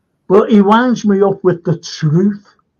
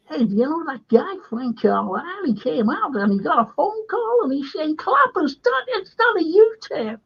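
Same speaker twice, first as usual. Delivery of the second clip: high-pitched bad American voice, climbing higher as it goes; hammed-up American, pitched way up; terrible American accent; 10 semitones higher than usual. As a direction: put on a badly faked, squeaky-high American accent. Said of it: "I've got a terrible American accent".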